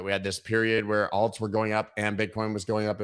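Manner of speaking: ordinary talk, nothing marked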